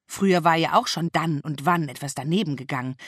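ordinary delivery, nothing marked

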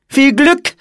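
put-on high, loud child's call; called-out farewell. A narrator's reading. Girl calls after departing old monk, high shout. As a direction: call the good wish after someone. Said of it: "rief Anne".

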